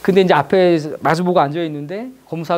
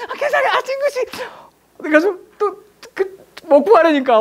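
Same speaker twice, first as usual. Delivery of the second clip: choked up; 9 semitones higher than usual. Real, not acted. Retelling a moving memory, choked up.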